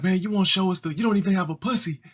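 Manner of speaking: high, fast mock kid taunt.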